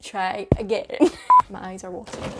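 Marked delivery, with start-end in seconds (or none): 0.9-1.5 shrieking giggle; high squealing laugh. Real, not acted. Blooper laugh.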